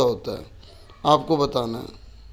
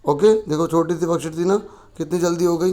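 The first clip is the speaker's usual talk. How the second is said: ordinary talk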